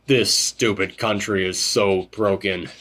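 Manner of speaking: low, quiet and slow, bitter; weary old man. Retold or imitated. Host quoting Akio's bitter line, low and quiet. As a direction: sigh it out low like a tired veteran. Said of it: "he was like".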